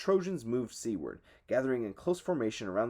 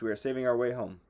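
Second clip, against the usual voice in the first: ordinary delivery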